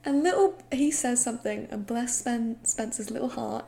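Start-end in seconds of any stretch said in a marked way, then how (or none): none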